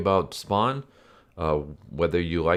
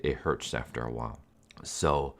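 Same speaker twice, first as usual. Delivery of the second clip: ordinary talk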